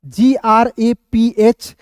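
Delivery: usual speaking voice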